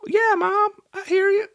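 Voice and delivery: squeaky high child voice, badly faked; hammed-up little kid answering; terrible kid voice. Kid answering mom on the radio, badly faked high voice. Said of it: "That's a terrible kid voice".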